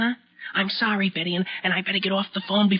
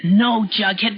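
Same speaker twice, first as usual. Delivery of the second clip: normal talking voice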